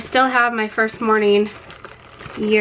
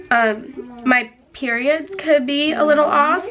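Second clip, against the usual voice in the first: normal talking voice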